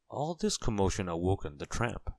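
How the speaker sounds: ordinary delivery, nothing marked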